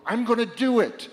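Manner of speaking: rising resolute vow; determined cry. Acted. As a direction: declare it with sudden resolve. Voicing resolve of compassion.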